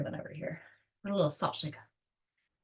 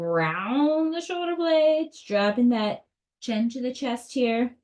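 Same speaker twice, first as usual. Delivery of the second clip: high slow cue; 6 semitones higher, 4 dB louder, slower than usual. Acted. Cueing the cat stretch.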